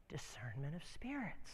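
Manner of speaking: very soft and low; hushed realisation. Retold or imitated. Suddenly discerning spirits beside his friend, hushed. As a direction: say it under your breath.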